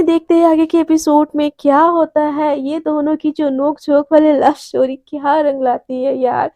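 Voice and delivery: ordinary delivery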